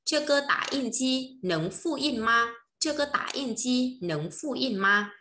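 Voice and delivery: Chinese sentence spoken slowly for repeating; slow model sentence. Shown as a demonstration. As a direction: drill it slowly and clearly. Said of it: "Mình sẽ nói là gì nhỉ".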